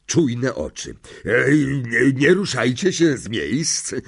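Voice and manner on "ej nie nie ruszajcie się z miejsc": hoarse, strained warning. A narrator's reading. Lapin warns the pupils to stay put, hoarse voice. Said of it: "powiedział tym samym ochrypłym głosem".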